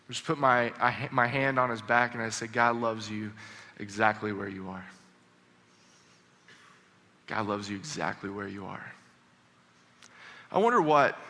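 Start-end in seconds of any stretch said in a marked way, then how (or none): 2.5-8.9 low, soft and tender; hushed, low comforting voice; gentle reassurance. Retold or imitated. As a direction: quietly reassure someone who is crying. Comforting the sobbing student.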